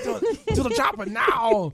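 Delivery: high, slow Austrian shout; Arnold Schwarzenegger. Acted. Quoting films with friends, Austrian action-hero shout. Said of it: "how to do Arnold Schwarzenegger".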